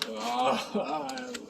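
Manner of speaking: long groan trailing off; exasperated groan. Real, not acted. Reviewer fed up with the training plot, exasperated groan. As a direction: groan in weary disgust.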